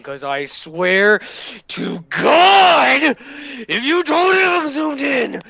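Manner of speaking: rising to a high, loud playful yell; hammed-up threat building to a shout; mock threat. Streamer jokingly threatening his editor to zoom in, mock shout.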